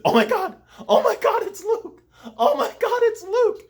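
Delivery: very high, loud fangirl squeal; high-pitched giddy squeal of disbelief; squealing like a schoolgirl. Retold or imitated. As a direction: shriek it like an overexcited little schoolgirl. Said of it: "I did really sound like a little schoolgirl".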